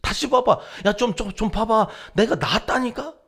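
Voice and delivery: loud, high insistent demand; stubborn pestering. Acted. Leper badgering the priest to re-examine him, pushy insistence. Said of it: "억지를 부리고 때를 쓰고".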